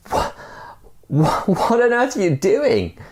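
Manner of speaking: high, stammering shout of alarm; startled shout. Acted. Man on bridge shouting down at the stranger, high alarmed shout. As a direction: sputter it out in shock. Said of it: "And he shouts".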